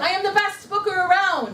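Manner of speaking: loud, high, proud declaration; powerful affirmation. Shown as a demonstration. Trainer has consultants repeat after her, powerful and boastful. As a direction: proclaim it for the room to echo. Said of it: "this is a powerful affirmation".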